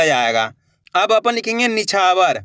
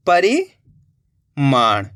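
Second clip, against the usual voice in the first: slow drawn-out word; 6 semitones lower, much slower than usual. Shown as a demonstration. Teacher to pupils revealing the finished word, very slow. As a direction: stretch परिमाण out very slowly, each sound held. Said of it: "तो अपने मिलके बन गया ये".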